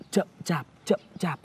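sluggish. Shown as a demonstration. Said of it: "kiểu slow motion".

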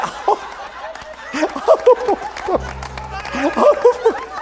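imitated high laugh behind a hand; muffled, high mock hooting; dentist laugh. Shown as a demonstration. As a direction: hoot with laughter while covering a missing tooth. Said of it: "la famosa risa del dentista".

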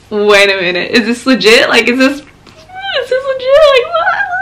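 disbelief climbing into very high pitch; incredulous reaction. Retold or imitated. Vlogger retelling her reaction to Gymshark's message, incredulous.

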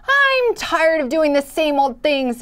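grumbled. Acted. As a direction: grumble it, bored and fed up. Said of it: "it grumbled".

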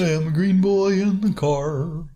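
put-on character voice. Odd put-on voice.

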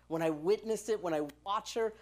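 ordinary talk, nothing marked

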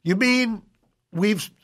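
ordinary talk, nothing marked

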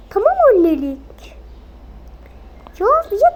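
put-on child shouting back; bratty yell. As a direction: snap back at mom. Child yelling back at mother.

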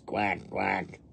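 two soft quacks; mama duck quacks. A narrator's reading. Mama duck to her babies, soft quacking. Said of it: "Mama duck says".

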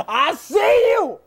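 drawn-out, slow shout; taunting shout. Real, not acted. Gamer calling out a spotted enemy, taunting shout. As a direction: holler it at the enemy you spotted.

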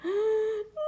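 sharp high frightened gasp; a startled seed gasping in fear. Acted. Seed character gasps in sudden fright.

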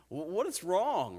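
slow, high, loud mock question; imitated baffled husband. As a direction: stretch it out like an exasperated husband. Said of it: "How many husbands have said that to their wives".

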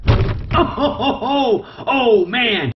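high, delighted laughing exclamations after the strike; real giddy laughter, pitch jumping way up; thrilled laughing whoop. Real, not acted. Keeper watching his gaboon strike, whooping with laughter.

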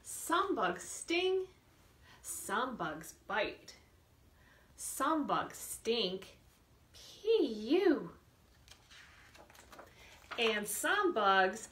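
slow, lilting storybook read for little ones; reading to children. Acted. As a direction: read it slowly to a class of small kids. Teacher reads picture book aloud.

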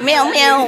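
imitated meow, loud and laughing. Man joking about a bilingual cat, laughing meow. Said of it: "bark at me".

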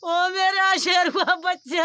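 crying lament, slow and loud; grieving wail, drawn out; tearful pleading movie mother. Real, not acted. Grieving mother wails for dead son.

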